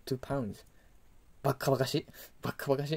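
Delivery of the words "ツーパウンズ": softer ツーパウンズ with a final z sound; ツーパウンズ in English-like pronunciation, plural z; plural ending. Shown as a demonstration. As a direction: repeat ツーパウンズ softly, ending in buzzed z. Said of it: "ツーパウンズになるんですよ".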